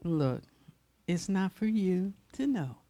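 calm version. Retold or imitated. Jesus answering the disciples, gentle and soft. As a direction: lay it out gently, no edge. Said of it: "I don't think he said".

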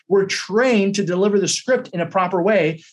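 normal talking voice

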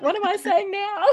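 half-joking flustered parent; mock bewildered. Host joking about parents reasoning with kids, mock flustered. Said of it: "to be like".

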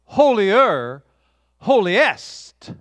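loud, climbing holy, holier, holiest; loud, ascending angelic praise; building angel cry. Acted. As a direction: ring it out, rising each time. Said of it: "the angels would be saying".

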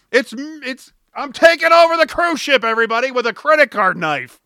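playfully announcing a ridiculous hijack; mock hijacker announcement. Mocking the idea of a hijacker.

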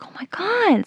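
high, soft mock squeal of delight; retold happy reaction. Friend hearing about the kiss, imitated as so happy. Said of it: "she's so happy".

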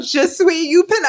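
insistent repeat. Retold or imitated. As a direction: keep saying it, high and eager. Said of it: "And I was like".

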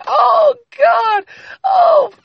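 very high, loud falsetto scream. Acted. Narrator voicing the woman at climax, drawn-out high scream. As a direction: shriek it, stretching each word out. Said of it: "And she's screaming".

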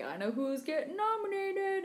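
high, drawn-out teasing singsong; gleefully taunting, slow and high. Acted. Teasing social media post acted out.

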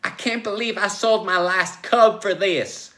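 high, loud outraged complaint; exclaiming. Acted. Joe regrets paying for therapy, outraged exclamation. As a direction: wail it loudly in outrage. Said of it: "Joe exclaimed".

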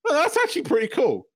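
high-pitched, impressed concession, laughing through it; amused, high mock-impressed voice; acted pleasant surprise. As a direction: play up being won over, pitched well up. Acting out being grudgingly impressed.

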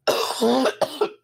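real coughing fit from choking. Real, not acted. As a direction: cough hard, something went down the wrong pipe. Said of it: "went down the wrong pipe".

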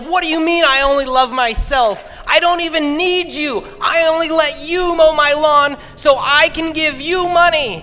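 high, loud indignant yelling back; retold furious shout. Yelling back at the neighbor who called him selfish, furious. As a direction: let him have it at full volume. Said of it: "I let him have it".

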